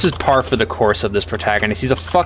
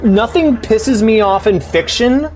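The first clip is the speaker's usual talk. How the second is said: angry rant; 9 semitones higher than usual. Real, not acted. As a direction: blow up at the character in real frustration. Ranting at the useless protagonist.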